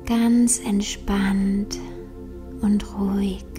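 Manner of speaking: slow whispered repetition. Acted. Repeated meditation phrase.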